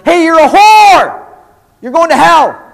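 very loud, high condemning shout; bullhorn-style yell. Acted. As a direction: yell it like a street protester with a bullhorn. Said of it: "and he yells".

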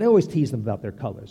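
ordinary delivery

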